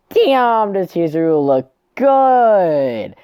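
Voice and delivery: high, loud, drawn-out exclamation; an over-the-top admiring cheer; excited gushing. Real, not acted. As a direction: gush with long, stretched words.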